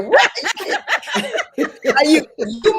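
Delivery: high-pitched incredulous yelp, played for laughs; hammed-up shocked reaction; mock disbelief. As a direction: squeal it in comic outrage. Retelling reaction to anniversary gift.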